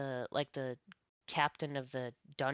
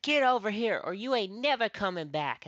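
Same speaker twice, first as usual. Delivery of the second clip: higher, louder threat from the coach; angry yell; 7 semitones higher, 7 dB louder than usual. Retold or imitated. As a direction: shout the ultimatum, pitched up and loud. Coach yelling at him.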